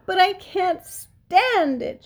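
high, drawn-out anguished cry; loud piglet wail of protest; distressed shout. A narrator's reading. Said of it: "shouted Wilbur".

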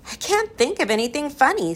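high, mildly desperate protest. A narrator's reading. Ben runs out of jokes, desperate. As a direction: strain upward, out of ideas. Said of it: "said Ben desperately".

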